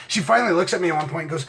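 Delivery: ordinary talk, nothing marked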